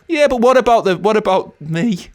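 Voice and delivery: high, loud, self-pitying mock plea; mock indignant protest. Husband butting in on his proud wife, mock indignant. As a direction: butt in loudly, playing hard done by.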